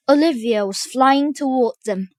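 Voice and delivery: plain reading voice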